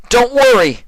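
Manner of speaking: very loud, high shout through door; calling out. Acted. Shining Armor outside the door, very loud urgent shout. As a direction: bang and call urgently. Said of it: "yelled Shining Armor".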